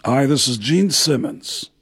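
lightly voiced rock star. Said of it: "As [name] from Kiss".

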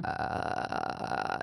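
low crackling fry; relaxed throat crackle; vocal fry. Shown as a demonstration. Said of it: "it's called vocal fry".

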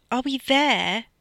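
high, loud whiny groan; drawn-out impatient whine. A narrator's reading. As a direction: groan it like a bored passenger. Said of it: "Liv groaned".

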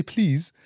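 "please" pronounced "bliz". Retold or imitated. Showing how Arabs say please, B for P. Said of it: "It sound, when you say".

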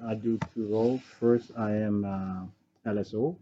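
ordinary talk

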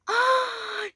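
high shocked gasp; a sudden in-breath of alarm; sharp gasp. Real, not acted. Reacting to the story twist.

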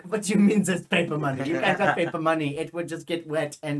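put-on loud, incredulous German accent; strong German accent. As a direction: scoff in a silly German accent. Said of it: "I had a really strong, stupid accent".

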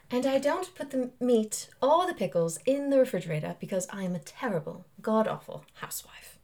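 put-on English accent. Shown as a demonstration. Actor redoing play line on request, English accent. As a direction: deliver it in stagey English accent. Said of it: "in an English accent?".

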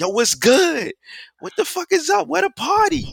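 very high mock party holler; hammed-up high holler; catcalling women. Acting out women's rowdy approach.

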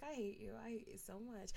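soft, under-the-breath I hate you; muttered hate. Retold or imitated. To herself about the scheming ex, softly muttered. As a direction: grumble it quietly to yourself. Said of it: "and I was like".

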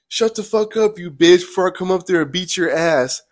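high-pitched imitation of an angry threat; man's retold yell. Stranger yelling back at the teller by the creek, imitated yell. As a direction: raise the pitch, mimic his yelled threat. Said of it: "a man's voice yells back at me".

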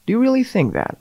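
high, incredulous "really?" dripping with sarcasm; sarcastic disbelief. Acted. Mocking an opponent's claim with sarcastic disbelief. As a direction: fake astonishment at someone's claim.

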